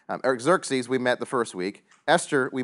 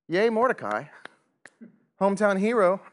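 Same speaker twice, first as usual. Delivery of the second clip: higher, louder mock cheering for a hometown hero; 7 semitones higher, 4 dB louder than usual. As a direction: cheer him on, half in jest.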